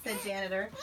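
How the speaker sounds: plain reading voice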